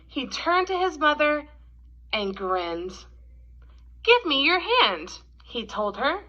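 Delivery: gentle, expressive storybook read-aloud; reading to children. A narrator's reading. Teacher reading The Kissing Hand to kids, gentle storytelling. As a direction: read it like a picture book at story time.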